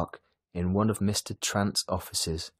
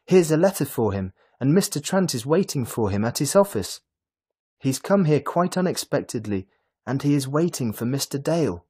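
higher, louder rushed urging; excited; 6 semitones higher, 6 dB louder than usual. A narrator's reading. Lily urging Mrs Dale to send her husband, excited. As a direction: hurry her along excitedly. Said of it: "Lily cried excitedly".

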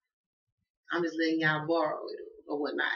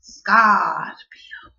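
loud breathy call of Scorpio; dramatic announcement; 3 semitones higher, 14 dB louder than usual. Acted. Announcing the next sign to viewers, loud and breathy.

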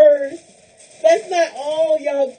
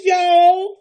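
ordinary delivery

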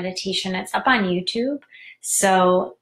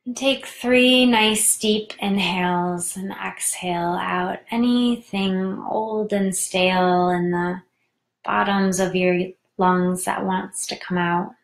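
measured meditation voice with long pauses; guided meditation. Real, not acted. Host guides listeners into tarantula meditation, unhurried guided-meditation voice. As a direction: guide them inward, leaving room to breathe.

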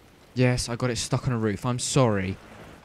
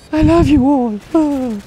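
much higher, slow and drawn out; squealed affection; 13 semitones higher, 7 dB louder, much slower than usual. Real, not acted. Youtuber to his viewers, begging not to bore them, squealed gushing.

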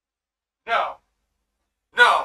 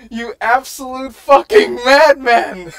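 laughing disbelief; 3 semitones higher, 11 dB louder than usual. Real, not acted. Ecstatic reaction, laughing.

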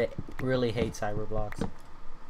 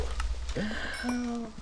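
stunned shrieking laugh; 11 semitones higher, 3 dB quieter than usual. Real, not acted. Gamer laughing at his ruined house, shrieking laugh. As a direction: laugh it off in shock.

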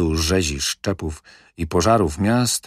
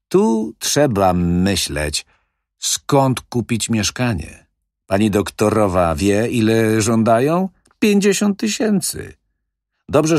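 louder, higher, breezy wheeler-dealer; smooth-talking manager; 5 semitones higher, 4 dB louder than usual. Acted. Manager brushing off the old widow's fears, breezy and loud. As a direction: brush off her worries with swagger. Manager boasts about flats.

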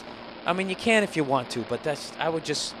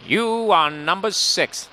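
slow, louder ominous pronouncement; Prisoner intro imitation; 3 semitones higher, 5 dB louder, much slower than usual. Answering the prisoner in a song intro, imitated ominous voice. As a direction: imitate a dramatic TV villain, drawing out the words. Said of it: "Do you remember how that thing went?".

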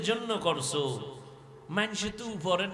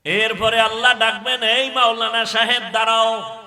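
full-force preaching; 5 semitones higher, 10 dB louder than usual. Acted. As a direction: thunder God's question at the martyr, stretching key words. Preaches, voicing God's summons.

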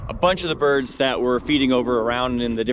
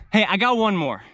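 ordinary delivery

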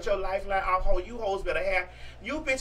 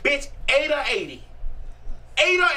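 mock threat; 3 semitones higher than usual. Acting out a confrontation.

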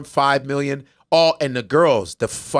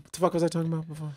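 fast aside; 8 dB quieter, much faster than usual. Real, not acted. Losing his thread mid-story.

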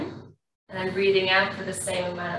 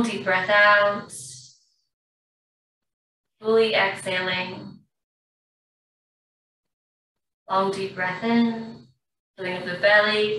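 guided breathing. Acted. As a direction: ease the class through each breath. Yoga teacher guides breathing.

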